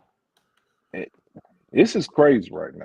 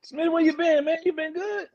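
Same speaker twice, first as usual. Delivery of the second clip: mock high greeting; 4 dB quieter than usual.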